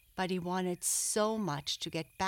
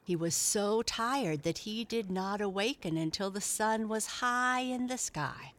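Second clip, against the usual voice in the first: plain reading voice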